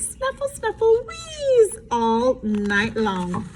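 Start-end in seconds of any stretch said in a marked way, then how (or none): 0.0-1.1 high snuffle sound effect; snuffling. A narrator's reading. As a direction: play the puppy snoring. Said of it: "she snored".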